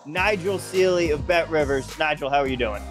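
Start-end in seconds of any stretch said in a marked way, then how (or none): none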